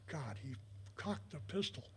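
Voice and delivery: low, hushed realization; retold dismay, dropped low and soft; hushed aside. Recalling his alarmed aside on hearing clicks.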